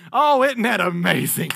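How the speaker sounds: ordinary talk, nothing marked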